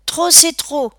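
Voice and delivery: high, loud protest; exasperated outburst. A narrator's reading. Gang member telling his friend enough, exasperated.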